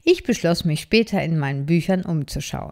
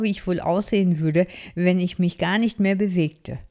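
plain reading voice

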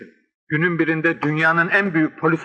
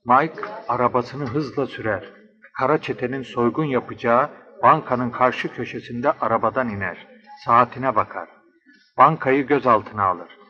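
low, measured storyteller; low-pitched adventure narration; detective story narration; 4 semitones lower than usual. Acted. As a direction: narrate a crime thriller in a lower voice.

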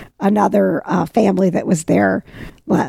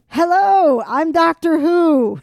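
high, drawn-out imitation of a doctor's greeting; doctor introducing himself; 9 semitones higher, slower than usual. Retelling how a man walked up to them, imitated grand introduction. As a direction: announce yourself grandly, stretching the name. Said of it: "this man walks up to us and says".

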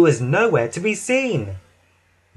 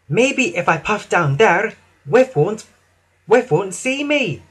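high voice, thinking aloud; engine character thinking; 6 semitones higher than usual. A narrator's reading. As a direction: plot your hiding in a higher voice. Emily plots to hide.

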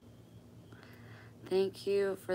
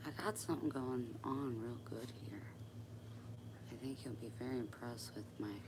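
breathy unvoiced whisper, much softer; whispering to herself; 4 semitones lower, 12 dB quieter than usual. Real, not acted. Sewer whispering to herself over her work. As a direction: whisper under your breath while you sew. Muttering to herself while sewing.

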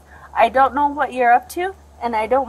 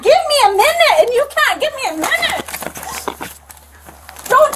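calm turning angry; 12 semitones higher than usual. Real, not acted. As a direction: shout it, at the end of patience. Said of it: "I said".

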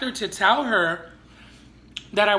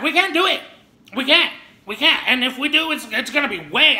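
mimicking the manager; 7 semitones higher, 5 dB louder than usual. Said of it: "She's like".

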